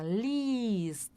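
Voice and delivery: liest with a long i and no e sound; liest, long clear ee. Shown as a demonstration. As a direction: stretch the i in liest. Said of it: "essa vogal é mais longa".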